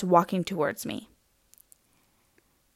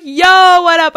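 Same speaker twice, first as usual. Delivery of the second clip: high, loud bro greeting; hammed-up casual hello; fake chill; 7 semitones higher, 8 dB louder than usual. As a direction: hail him like your best buddy. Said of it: "so chill".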